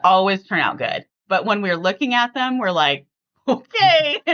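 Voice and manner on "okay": high, laughing okay; a skeptical okay that breaks into a laugh; half-laughing wary okay; laughing doubtful okay. Retold or imitated. Unsure about Brent's restaurant picks.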